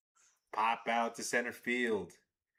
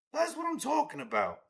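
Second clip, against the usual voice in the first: excited cheer. Real, not acted. Celebrating again, repeated cheer.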